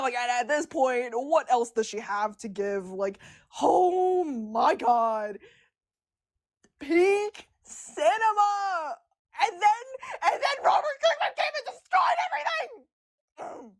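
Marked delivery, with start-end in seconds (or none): 3.6-9.0 drawn-out, high gushing; excited. Real, not acted. Fan gushing about Alita's scene, slow and rapturous. 9.4-13.7 very high, shouted half-laughing rant; laughing outrage. Real, not acted. Fan ranting that the writer ruined Alita, high laughing shout.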